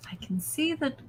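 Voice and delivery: high, slow, knowing cultist voice; sly entranced cultist. Acted. Entranced cultist Barden to the player, high and slow. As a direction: purr it out like a mad cultist who sees through him.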